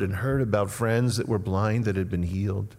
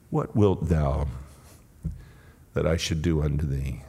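hushed, measured authority, pitched down; tender, powerful voice; 6 semitones lower than usual. Acted. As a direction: ask gently, with weight and long pauses. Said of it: "How tender, how powerful".

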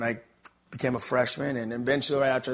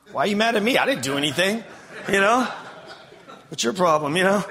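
lightly voiced God, higher and louder, exasperated; God's imagined reply; 7 semitones higher, 5 dB louder than usual. Speaker voicing God answering an angry man, mildly exasperated. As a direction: play God baffled and a bit fed up. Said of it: "he's kinda probably thinking".

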